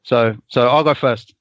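usual speaking voice

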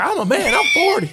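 mock furious rant; mock Gundy shout. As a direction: fire it back at a challenger. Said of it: "I'm like".

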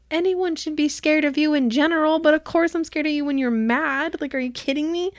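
high, emphatic retold protest; loud, high-pitched exasperated imitation; mock incredulous retort. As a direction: protest as if it's completely obvious. Acts out exasperated retort to her.

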